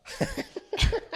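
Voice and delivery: high laughing gobble attempt; gobbling turkey voice. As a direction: try a turkey gobble, giggling. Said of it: "Best turkey impression".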